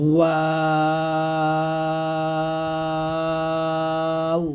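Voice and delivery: ওয়াও held long with rounded lips; long drawn-out waw. Shown as a demonstration. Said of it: "আমার সাথে আপনারা বলেন".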